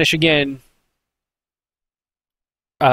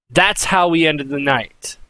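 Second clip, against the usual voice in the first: ordinary delivery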